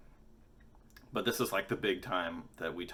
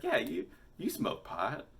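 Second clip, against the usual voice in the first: put-on teen insisting; 8 semitones higher than usual. Student insisting.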